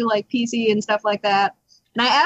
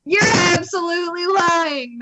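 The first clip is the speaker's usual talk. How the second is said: higher-pitched, mock-outraged call-out; indignant accusation; 6 semitones higher than usual. Customer to lying repair guy, mock indignant. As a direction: accuse him in exaggerated disbelief.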